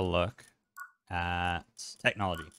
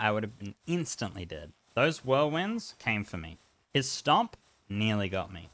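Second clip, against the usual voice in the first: usual speaking voice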